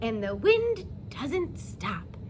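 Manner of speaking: put-on slow, lilting read-aloud to young children; storybook reading. A narrator's reading. Teacher reading a bedtime story to her class, gently lilting. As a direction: read it like story time for little kids.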